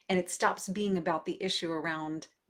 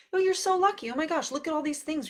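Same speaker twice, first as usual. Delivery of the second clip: high, loud, over-bright cheerleading; gushing reassurance; 10 semitones higher, 5 dB louder, faster than usual. Retold or imitated. Parent brushing off a son's unlucky feeling, mock gushing. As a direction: imitate a parent gushing to talk a child round.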